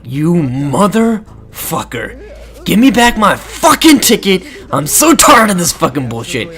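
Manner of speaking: slow build into high-pitched rant; hammed-up fed-up outburst; mock fury. Player demanding the ticket back, mock furious.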